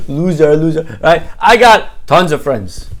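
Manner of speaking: loud mocking laugh into bragging; jeering boast. Son calls dad a loser, jeering. Son jeers at his father.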